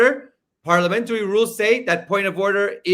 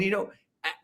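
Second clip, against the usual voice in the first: ordinary talk, nothing marked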